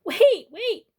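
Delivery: high, loud cry after him; calling out. Acted. Bubby chasing the boy, loud high call. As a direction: shout after the runaway. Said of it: "the old woman cried".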